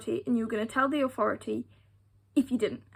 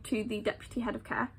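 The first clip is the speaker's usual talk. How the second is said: whispered aside; 7 dB quieter than usual. Real, not acted. Unvoiced aside naming the person.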